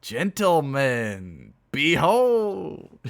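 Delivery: very slow, loud, rising proclamation; grand announcement. Acted. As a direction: stretch it out like a ringmaster. Heavy one shouting for attention.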